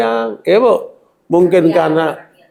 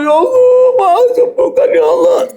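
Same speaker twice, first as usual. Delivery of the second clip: much higher, wailing plea to God; mock weeping prayer; 3 dB louder than usual. Preacher imitating a sick woman crying to Allah, high mock wail. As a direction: whine it like a sick woman begging for a cure. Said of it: "Wah, nangis".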